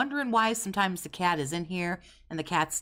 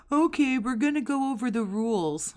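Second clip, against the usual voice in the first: mocking host voice; 8 semitones higher, 3 dB louder than usual. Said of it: "is like".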